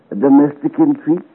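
ordinary talk

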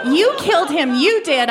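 high loud accusing scream. A narrator's reading. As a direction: yell the accusation in despair. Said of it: "I screamed".

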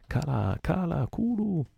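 high, slow howling chant; howled chant. Acted. Queequeg howling at the rowers, high and slow. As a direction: howl it like a war cry. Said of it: "ululò Kikeg".

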